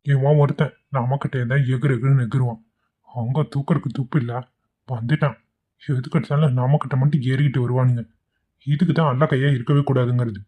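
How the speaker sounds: low resentful mutter to himself; henchman's soft sulky grumble; grumbling under breath. Acted. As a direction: complain quietly so the boss won't hear.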